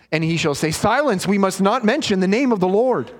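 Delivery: ordinary delivery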